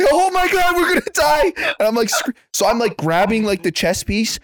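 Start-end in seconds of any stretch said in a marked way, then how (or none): 0.0-1.5 mock scared cry. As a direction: wail it like the ride is falling apart. Scared on the ride, retold.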